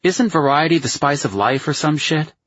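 high-pitched friend musing half-seriously; half-assed philosophical. A narrator's reading. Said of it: "some sort of half-assed philosophical mood".

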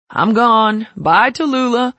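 loud high goodbye call. A narrator's reading. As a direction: sing out a loud teasing bye. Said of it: "he called out".

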